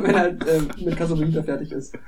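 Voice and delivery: ordinary delivery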